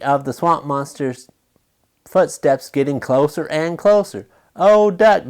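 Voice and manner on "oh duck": higher, louder moan; a storybook wail of despair. A narrator's reading. Goose to Duck, hiding, a hammed-up moan. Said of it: "Goose moaned".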